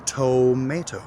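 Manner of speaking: TOMATO as TOH-MAY-toh, first O fully sounded; full first O. Shown as a demonstration. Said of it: "pronounces the O".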